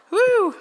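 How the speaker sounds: high, short "woo"; whoop. Real, not acted. Preacher regrouping before reading to the congregation, high whoop. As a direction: let out a quick high whoop.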